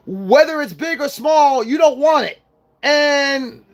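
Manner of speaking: real ranting shout about the principle; heated shout. Real, not acted. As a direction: drive the point home, shouting. Heated rant, shout heard.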